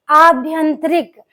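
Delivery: आभ्यंतरिक slowly, each syllable clear; careful slow pronunciation. Shown as a demonstration. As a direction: spell out आभ्यंतरिक by ear. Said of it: "क्या होगा". (आभ्यंतरिक धीरे-धीरे साफ़ बोलें)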